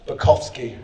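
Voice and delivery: Bukowski as boo-KOF-ski, Polish way; Polish pronunciation. Shown as a demonstration. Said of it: "if you're Polish".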